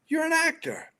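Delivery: high-pitched, incredulous imitation; mock astonishment. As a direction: jump up in pitch as if catching someone out. Officer's astonished reaction, retold.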